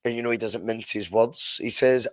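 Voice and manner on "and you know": ordinary delivery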